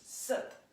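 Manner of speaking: "Sut" pushed out from the diaphragm; breathy emphatic "Sut"; punchy sut. Shown as a demonstration. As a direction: crest the wave on "Sut". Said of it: "visualize a wave of the diaphragm".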